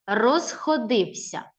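Розходився slowly split into syllables, stress shown; syllable reading. Shown as a demonstration. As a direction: read Розходився slowly and clearly. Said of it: "Прочитай правильно".

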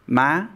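ma rising high; excited tone. Shown as a demonstration. Teacher demos the sharp tone for learners. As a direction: lift ma up excitedly. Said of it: "excited or exasperated tone".

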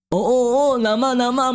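ordinary delivery, nothing marked

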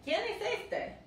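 high-pitched, mock-impolite phone query; mock rude question. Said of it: "es mal educado en español decir".

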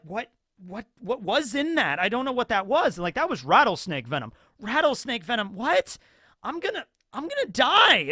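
high, rising panic; panicked customer. Retold or imitated. Poisoned customer realizing he'll die, high panic. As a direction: climb into mock terror.

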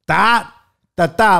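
hammed-up Italian exclamation; mock Italian. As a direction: punch each syllable like an Italian caricature.

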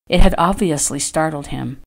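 usual speaking voice

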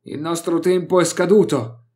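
loud, high shout over the wind. Acted. Vok shouts a warning over the storm. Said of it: "mi gridò Vok".

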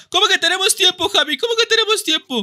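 high-pitched panicked protest; alarmed disbelief. Real, not acted. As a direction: react in high, startled panic. Startled by the time limit.

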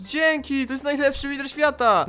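high-pitched gushing excitement; delighted. Real, not acted. Player showered with diamonds, delighted exclaiming. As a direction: gush with thanks, pitched high.

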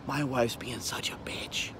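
hushed, furtive whisper; whispered confiding. Acted. Husband venting to a friend out of wife's earshot, whispered. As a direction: whisper it so she won't overhear. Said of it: "everyone always whispers that, right?".

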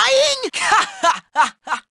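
long, staccato mocking laugh. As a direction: laugh at someone's failure. Laughing at the other character.

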